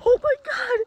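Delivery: sudden high shriek; startled squeal; surprised. Real, not acted. Stepping into cold pool.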